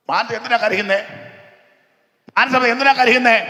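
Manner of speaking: normal talking voice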